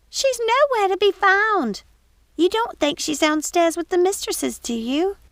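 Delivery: put-on alarmed young housekeeper pleading; high excited servant's voice; Missus Pickle, urgent.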